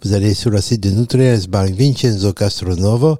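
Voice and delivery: put-on French with a playful southern Italian accent; Italian radio guest speaking accented French. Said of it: "ils adorent un accent du Sud".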